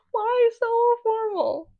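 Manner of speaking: very high, long-held mock wail; flustered squeal. Comic swooning over the formally dressed librarian, flustered squeal. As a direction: stretch it out in a squealing, laughing lament. Repeats outburst over the outfit.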